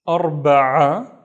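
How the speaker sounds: "أربعة" with a loud, squeezed throat ain; vomiting sound. Shown as a demonstration. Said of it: "the vomiting sound".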